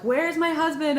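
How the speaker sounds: high, loud panicked plea; freaking out. Retold or imitated. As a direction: demand it in a panic. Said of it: "I'm just, like, freaking out".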